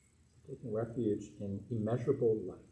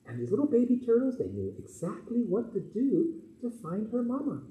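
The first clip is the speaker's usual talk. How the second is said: put-on bright high storybook voice for kids; reading to children; 9 semitones higher than usual. Minister reads a turtle story to the children in a high, gentle voice. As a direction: tell it sweetly to the little ones.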